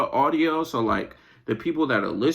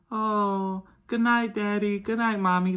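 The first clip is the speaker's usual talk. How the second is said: lightly voiced sweet child, a bit higher; sweet kids; 7 semitones higher than usual. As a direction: sound like a sugary kid at bedtime. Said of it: "they come like".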